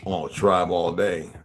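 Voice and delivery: ordinary talk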